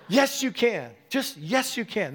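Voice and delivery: emphatic, high-pitched rallying cry; strong exhortation. Retold or imitated. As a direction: drive it home to stir her up. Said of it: "it was really strong".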